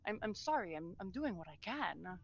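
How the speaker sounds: soft, faltering sorry; barely above a murmur, overwhelmed; quiet apology. Acted. Lady softly apologizes to her suffering people.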